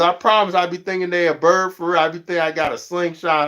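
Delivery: usual speaking voice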